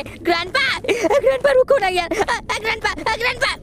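fleeing gamer screams at chasing grandpa; panicked screams. Real, not acted. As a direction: wail and beg grandpa to stop. Grandpa attacks again.

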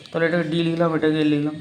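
ordinary talk, nothing marked

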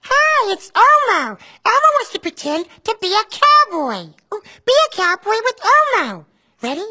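Elmo voice. Acted. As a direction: speak as cheerful Elmo to a child. Elmo's voice throughout.

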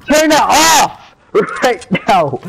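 slow, stretched-out order; drawn-out command. Real, not acted. Ordering a friend to stop clipping, slow and emphatic. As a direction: drag out every word to make the demand land.

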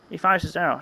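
plain reading voice

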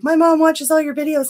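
lightly voiced excited schoolgirl; imitating a schoolkid. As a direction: pipe up like a kid telling the teacher. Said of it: "she was like".

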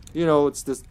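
ordinary delivery

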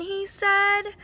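ordinary delivery, nothing marked

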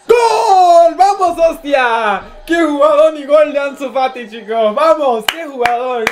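screaming goal celebration; breakneck goal commentary. Real, not acted. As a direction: yell it out like a goal call. Goal celebration while watching match.

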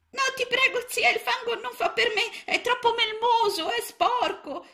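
very high, small frog voice, begging; a tiny frog begging not to be muddied; pleading little frog. A narrator's reading. Little frog begging aunt not to spread mud, high plea.

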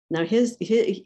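usual speaking voice

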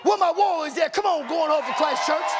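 high, rousing call to the crowd; rallying call. Real, not acted. Preacher calling for the warriors, rallying call. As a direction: call out loud across the church.

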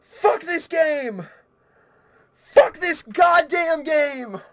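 slow, high, loud swearing; upset, high-pitched outburst; distraught cursing. Real, not acted. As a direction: curse the game, drawn out and shaken. Cursing game after the scare.

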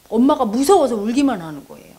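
normal talking voice

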